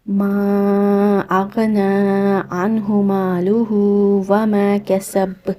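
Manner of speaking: slow, drawn-out melodic tajweed recitation; Quran recitation. Shown as a demonstration. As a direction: chant each word long and measured like a qari.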